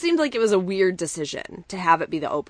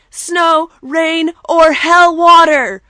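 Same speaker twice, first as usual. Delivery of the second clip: high, booming shout, slowing on each item; Psycho Sid impression; 9 semitones higher, 13 dB louder, much slower than usual. Wrestler listing what can't stop him, imitated promo shout. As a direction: bellow the list like a ranting wrestler, drawn out. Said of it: "this is my favorite line".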